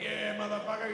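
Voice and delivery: high-pitched thrill-ride cheer; exhilarated. Retold or imitated. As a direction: whoop it up like a rider mid-loop. Acting out joy on the roller coaster.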